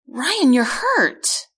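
slow, high alarmed cry; distressed cry. A narrator's reading. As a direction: cry out in alarm, stretching the words. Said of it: "I cry".

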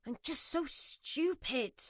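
slow, bitter self-reproach; self-loathing. Acted. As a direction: mutter it, hating yourself. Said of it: "her voice full of self-loathing".

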